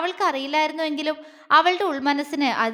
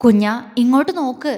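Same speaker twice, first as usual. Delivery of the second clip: usual speaking voice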